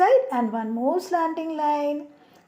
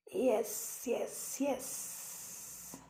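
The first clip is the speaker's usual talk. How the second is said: whispered yes; 3 semitones lower, 15 dB quieter, much slower than usual. Real, not acted. Soft whispered yeses.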